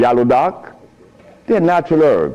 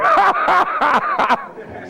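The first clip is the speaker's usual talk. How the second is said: laugh. Real, not acted. Big laugh after punchline.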